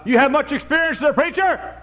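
high, loud skeptical question; questioning fellow. Retold or imitated. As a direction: quiz the young preacher like a doubting stranger. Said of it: "a fellow said one time".

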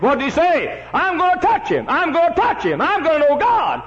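high, loud impassioned preaching; fervent preacher's cry; preaching at full force. Acted. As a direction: cry it out to the congregation.

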